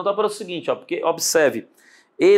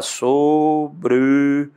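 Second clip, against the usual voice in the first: "Sobrevieram" stretched out very slowly; drawn out slowly; 3 dB louder, much slower than usual. Shown as a demonstration. Teacher slowly sounding out "Sobrevieram" for the students. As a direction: drag out "Sobrevieram" so each syllable is heard. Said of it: "como é que separa?".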